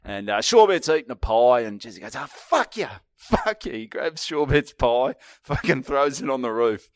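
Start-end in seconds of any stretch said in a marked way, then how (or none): 2.2-3.7 high-pitched, indignant cursing; mock-furious high yelp; retold angry outburst. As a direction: act out the riled-up little mate, pitched way up. Retold drunk mate snapping.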